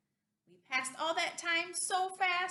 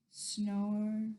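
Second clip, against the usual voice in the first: drowsy storybook snoring, trailing away; sleeping dinosaur; 5 semitones lower, much slower than usual. Acted. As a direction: say it like slow sleepy breathing. Dinosaur has fallen asleep.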